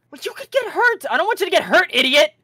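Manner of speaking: high, loud worried yell; angry shout. Acted. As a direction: yell at her out of worry. Brother yelling at sister.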